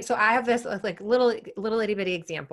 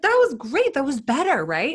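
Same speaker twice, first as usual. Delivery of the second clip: higher, louder encouraging cheer; excited praise; 6 semitones higher, 5 dB louder than usual. Retold or imitated. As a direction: pump her up with bright praise. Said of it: "I was like".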